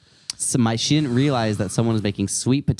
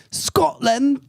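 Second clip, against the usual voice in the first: Scotland said high and rolled in mock Scottish; Scottish accent. Showing her only Scottish word to friends. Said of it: "Scotland ... That's all I can say".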